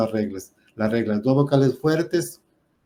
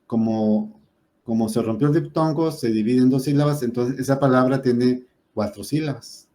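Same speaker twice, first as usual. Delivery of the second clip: ordinary talk, nothing marked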